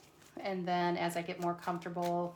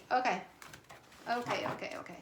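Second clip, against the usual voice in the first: normal talking voice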